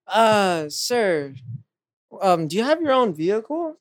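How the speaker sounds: lightly voiced hesitant official asking; a hint of a hesitant instructor. Retold driving instructor asking.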